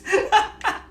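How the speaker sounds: high burst of laughter; loud high laughter. Real, not acted.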